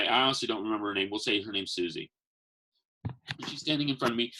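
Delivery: usual speaking voice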